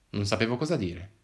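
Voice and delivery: ordinary delivery, nothing marked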